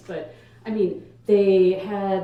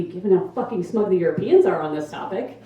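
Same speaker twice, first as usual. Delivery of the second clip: ordinary delivery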